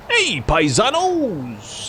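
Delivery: slow, drawn-out hammed-up Mario greeting; Lou Albano. Streamer imitating a Mario actor's greeting, slow and hammed-up. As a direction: stretch it out like a cartoon plumber. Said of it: "Lou Albanoing".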